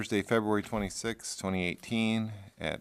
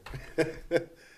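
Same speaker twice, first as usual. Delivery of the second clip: amused laugh; 8 semitones higher, 4 dB louder than usual. Real, not acted. Laugh after teasing remark.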